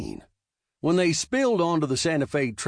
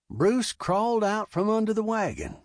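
ordinary delivery, nothing marked

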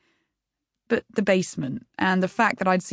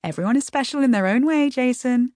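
lilting, teasing sing-song; 6 semitones higher than usual. Acted. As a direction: sing it out breezily to deflect. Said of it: "assuming a sing-song voice".

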